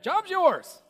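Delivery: high, bright hiring-manager voice; interviewer voice. Pretend. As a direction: play the delighted interviewer handing over the job. Voicing the interviewer.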